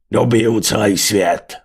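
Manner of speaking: slow, loud brutish bellow; roared boast. A narrator's reading. Venátor brandishes a roasting stick, roaring his ambition. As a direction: bellow it like a conquering brute. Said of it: "zařval venátor".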